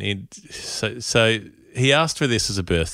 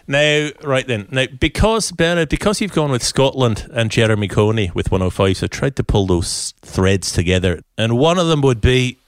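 put-on Scottish accent, playfully grumbling. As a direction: perform it as a lively Scot on request. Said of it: "tackle this in your Scottish accent".

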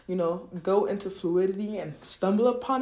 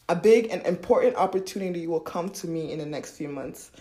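ordinary delivery

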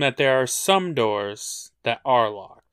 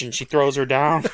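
ordinary talk, nothing marked

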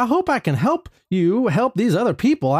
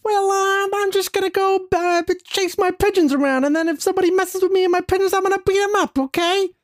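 high lispy boxer voice, pitched up; Mike Tyson; 9 semitones higher than usual. Acted. Boxer tells the therapist about his pigeons, high lispy voice. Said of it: "Mike Tyson".

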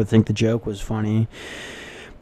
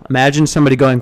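normal talking voice

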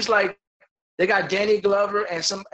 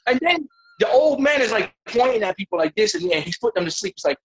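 normal talking voice

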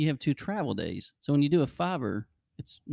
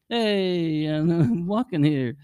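put-on New York accent; 6 semitones higher, 3 dB louder, much slower than usual. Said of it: "and he goes".

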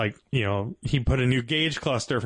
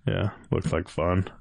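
low, understated sarcasm; sarcastic; 5 semitones lower than usual. Real, not acted. Rally friend commenting on another guy's rebuilding job, sarcastic. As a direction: say it drily, pitched down, not meaning it.

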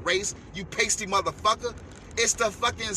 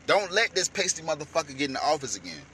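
normal talking voice

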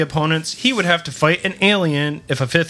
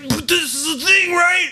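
excited pitch; 10 semitones higher than usual. Retold or imitated. Hyped coked-up writers pitching.

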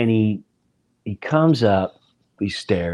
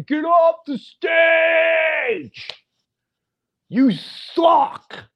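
drawn-out yell, much higher and louder; heckling shout; 4 dB louder, much slower than usual. Retold or imitated. Teen metal fan heckling the opening act, slow furious yell. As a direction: scream it like an angry teen at a concert. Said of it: "both middle birds flying".

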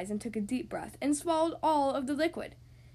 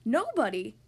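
louder, startled question; astonished gasp; 5 dB louder than usual. Acted. Mister Happy hearing the stranger's name, gasping in surprise. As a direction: blurt it out in astonishment. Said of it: "gasped Mister Happy".

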